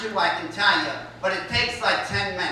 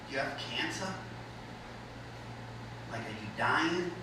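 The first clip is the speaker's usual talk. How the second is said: soft and quiet, suddenly caring; gentle concerned tone; 7 semitones lower, 15 dB quieter than usual. Retold or imitated. Asking the girl at Panera, softly and caringly. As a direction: ease way down like a worried friend. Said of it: "And my tone completely changed".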